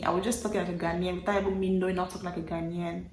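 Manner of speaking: ordinary delivery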